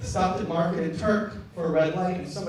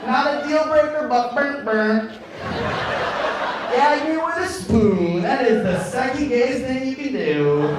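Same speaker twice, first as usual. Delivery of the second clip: loud, high, campy protest; mock disgust; 7 semitones higher, 7 dB louder than usual. Bert reacting to his girlfriend's request, loud mock disgust. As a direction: pour on exaggerated disgust at full voice.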